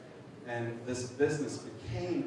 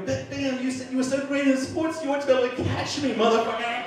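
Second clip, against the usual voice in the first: high, loud, rapid mock taunt; gleeful hollered comeback; taunting shout; 14 semitones higher, 8 dB louder than usual. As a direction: tease the pursuer at the top of your voice. Taunting his pursuer while running.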